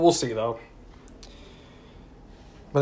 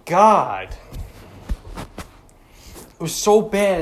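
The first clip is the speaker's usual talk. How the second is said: disgusted; 3 semitones higher, much slower than usual. Real, not acted. Disgust at the match.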